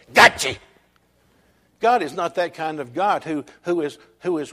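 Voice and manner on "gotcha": sudden loud burst, as if catching someone out; mock pouncing shout. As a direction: pounce on it loudly in pretend triumph. Imagined God pouncing on a worrier.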